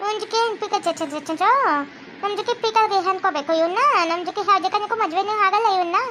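put-on a sulky Chinese girlfriend; Chinese girl accent. Chinese girlfriend complains about Manoj's family, in a Chinese accent.

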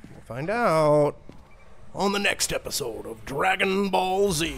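mock cliffhanger sign-off, stretched out big; episode preview announcer. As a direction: tease the next episode like an announcer. Mock next-episode TV announcer outro.

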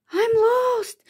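high-pitched, plaintive little cry; wailing. A narrator's reading. Spinderella suddenly alone outside, wailing high. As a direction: wail it like a lost child. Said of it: "she wailed".

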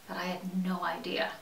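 low, soft, near-whispered admission; hushed regret. Acted. Inmate admits misjudging a ten-year sentence, hushed regret. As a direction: let it sink to a quiet murmur.

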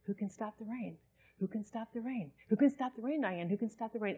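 soft, hushed insistent question; quiet inner voice. Retold or imitated. Preacher recalling the voice asking again, hushed. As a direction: keep nudging softly, over and over. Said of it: "this voice starts again".

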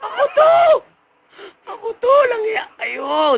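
very high wailing complaint; hammed-up pained protest. Whipped medium protests it is only them, high mock wail. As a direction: squeal in comic agony.

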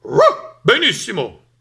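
sharp high bark, then loud approval; barking dog. A narrator's reading. Dog approves the duck's news, barking loudly. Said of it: "abbaiò Cane".

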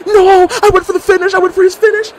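high, loud outraged shout; frustrated yelling. Real, not acted. Streamer losing his finisher, shrill outraged yell. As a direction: scream it like the win was stolen.

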